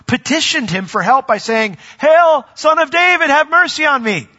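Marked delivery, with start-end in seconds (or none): 2.0-4.3 high, loud plea to a passing healer; calling out. Retold or imitated. Crowds calling to Jesus for mercy, loud high cry. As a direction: cry out for help, raised and pleading.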